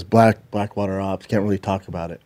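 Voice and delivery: low, hushed mock secret-operative brush-off; imitated secretive evasion. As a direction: drop it down, like classified business. Retelling a faker's evasive secret-ops boast.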